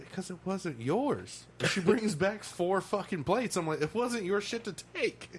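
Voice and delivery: indignant retort. Retold or imitated. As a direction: snap back, offended. Retold retort to server.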